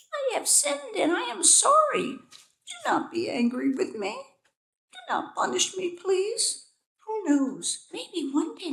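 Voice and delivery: very high, pleading tiny bee voice. A narrator's reading. Young bee begging the king, high pleading. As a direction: beg for mercy in a tiny high voice. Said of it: "The bee continued".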